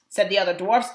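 ordinary delivery, nothing marked

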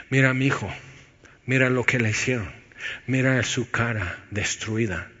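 soft, gentle answer; God's tender voice. Pretend. God answering the scarred man, softly and gently. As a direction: speak softly, like a father comforting his son. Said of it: "Y Dios dice".

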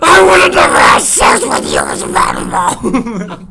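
loud, slow ranting shout; mock angry shout. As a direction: yell it over-the-top. Dad yelling at baby.

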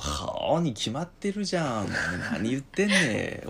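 slow, drawn-out swaggering 'obviously'; cocky of-course retort. Shown as a demonstration. Teacher acts out the nuance of 好, cocky and drawling. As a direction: drag it out as if the question is absurd. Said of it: "それから表情".

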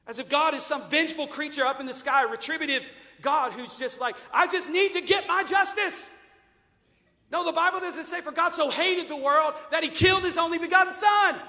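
loud, high, shouting preacher; preacher at a shouting climax; full-force preaching. Acted. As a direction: thunder the truth at them.

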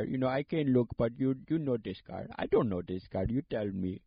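imitated Indian accent, pushy. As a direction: play the relative pressing the seller in an Indian accent. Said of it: "every person in this family ... sound exactly the same".

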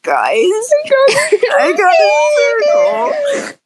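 a hammed-up kid sobbing the bad news; whiny fake-tearful wail to the parents; mock crying confession. Confessing to parents, acted quote.

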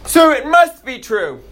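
high, slow mock-pompous voice; mocking the scientists. Dad mocking scientists for his kids, high and slow. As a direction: mimic them as pompous and silly. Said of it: "Now they wanna say".

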